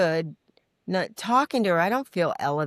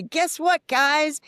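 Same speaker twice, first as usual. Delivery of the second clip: high, loud cheerful "guess what"; excited call to the crew; 11 semitones higher, 5 dB louder, much slower than usual. Retold or imitated. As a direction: call out to everyone, bursting with news. Announcing to the film crew.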